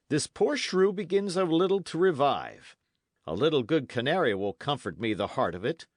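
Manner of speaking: a hint of hearty character voice; lightly voiced knight. Knight tending the fainted lad, lightly animated.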